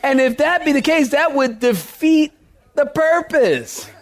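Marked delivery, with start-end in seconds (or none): 1.6-3.9 slow, drawn-out defeat, pause before the purpose; pun on DEFEAT. Shown as a demonstration. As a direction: land defeat as de-FEET, slowly. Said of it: "I worked on that".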